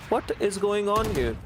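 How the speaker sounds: high, fast, astonished call; excited disbelief. Real, not acted. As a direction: shout it out amazed. Caster reacts to a multi-kill.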